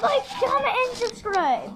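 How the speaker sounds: squealing cartoon voice; high shouted silly voice. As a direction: shriek it fast and high.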